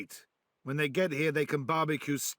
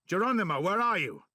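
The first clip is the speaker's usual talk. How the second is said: calling out; 4 semitones higher, 4 dB louder than usual. Acted. Calling out for missing ally.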